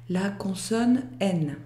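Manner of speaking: ordinary talk, nothing marked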